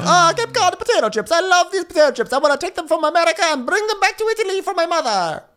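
very high, loud cartoon voice; hammed-up rapture in a silly high voice; mock chip enthusiast. Raving about potato chips for his mother, hammed-up high voice. Mocking an Italy-bound chip lover.

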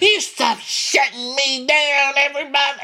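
mock-outraged reality-star whine; mock Catherine. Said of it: "She's like".

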